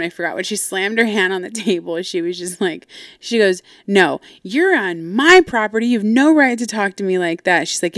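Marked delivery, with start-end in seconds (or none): 3.9-7.7 loud, higher, swooping emphasis; angry rebuke. Retold or imitated. Mom slamming the table at daughter, acted angry rebuke. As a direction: imitate a mom's forceful telling-off. Mom shouting back in quarrel.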